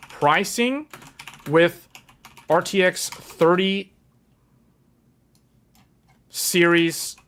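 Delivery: slow, word by word with long gaps; dictating while writing. Real, not acted. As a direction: say it slowly as you write it down. Says it aloud while writing it down.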